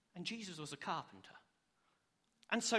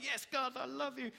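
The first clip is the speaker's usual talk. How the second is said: higher, exuberant praise; joyful, raised-pitch cry of devotion; eager worship; 6 semitones higher than usual. Retold or imitated. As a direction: act out springing up to praise God. Acts out jumping up to worship.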